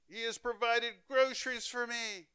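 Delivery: high, thrilled announcement; excited. Retold or imitated. Old lady overjoyed at her groceries, high excited cry. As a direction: tell the world in delight. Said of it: "she cried out".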